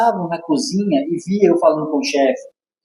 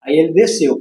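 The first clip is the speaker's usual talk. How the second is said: usual speaking voice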